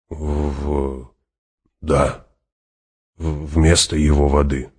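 faltering. Acted. Hawat echoes the Fremen's words, hesitant and faltering. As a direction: stumble on the first sound, then settle downward.

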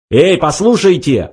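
a touch of a bark; barked call. A narrator's reading. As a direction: call out sharply for attention. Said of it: "гаркнул Форд".

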